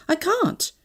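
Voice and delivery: high, flustered refusal; stammering. A narrator's reading. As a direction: refuse while taken aback. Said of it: "I stammered".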